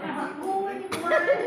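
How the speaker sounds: high, loud happy cry of recognition; delighted recognition. Retold or imitated. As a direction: greet her like long-lost family. Man repeats his delight. (seru gembira mengenali)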